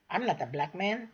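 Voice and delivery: ordinary talk, nothing marked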